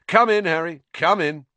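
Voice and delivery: high, loud and beaming; gushing vain wizard waving him in; Lockhart's voice. Acted.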